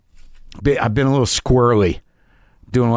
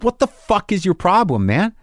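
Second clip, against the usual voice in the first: agitated confrontation; 8 semitones higher than usual. Retold or imitated. Walked up to him in the hallway, agitated. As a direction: confront him, voice riding up high.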